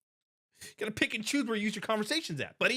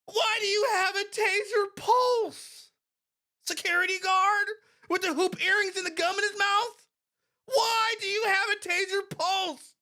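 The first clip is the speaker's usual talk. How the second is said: much higher, outraged disbelief; incredulous shout; 8 semitones higher than usual. Real, not acted. Incredulous at guard's taser.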